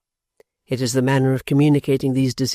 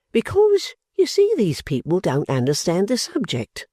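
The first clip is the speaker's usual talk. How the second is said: Pancks; 6 semitones higher than usual. Acted. Pancks voiced, high start.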